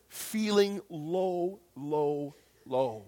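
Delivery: slow, drawn-out words stepping down in pitch; heavy, descending repetition; sinking low. Retold or imitated. Acts out feeling low.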